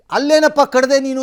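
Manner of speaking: loud, high challenge; mock confrontation. Acting out voters challenging the leader.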